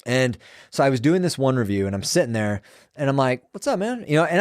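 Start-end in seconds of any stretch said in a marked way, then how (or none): 3.5-4.0 casual greeting. Retold or imitated. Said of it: "like this, right? It's very normal".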